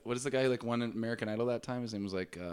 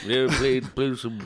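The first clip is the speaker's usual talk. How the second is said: loud mock-English voice; badly faked uptight English; uptight English accent; 6 dB louder, slower than usual. Playing up a friend's Englishness, mock uptight English accent. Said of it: "like an uptight English person".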